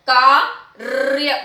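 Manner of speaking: कार्य slowly as "kaa... rrr-ya"; correct rolled r. Shown as a demonstration. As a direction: part "kaa" from a long trilled "rrr-ya" in कार्य. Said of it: "सही शब्द बनता है".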